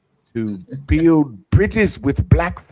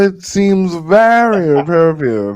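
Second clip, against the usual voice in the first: mock Elizabethan English accent on "it seems very appropriate", grand and loud; 4 semitones higher, 8 dB louder than usual. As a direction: lift "very" high in a pompous old English lilt. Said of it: "that English Elizabethan accent".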